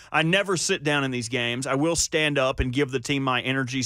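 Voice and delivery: ordinary talk, nothing marked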